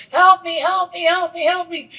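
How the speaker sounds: high, pleading cry, repeated without a break; mock yell for help. Stranded man begs another passerby for help, high mock yell. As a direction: pitch it way up like a man calling from a hole. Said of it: "and he's yelling".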